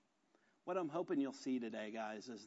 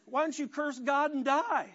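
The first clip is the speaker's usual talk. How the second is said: higher, laughing jab of a mocking wife; scornful wife; 11 semitones higher, 7 dB louder than usual. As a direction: throw it out as a sneer. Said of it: "She comes out and says".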